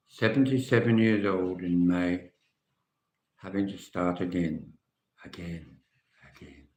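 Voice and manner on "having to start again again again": weary fading. Real, not acted. Man dreading starting over at seventy-seven, wearily fading away. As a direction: fade steeply with each repeat.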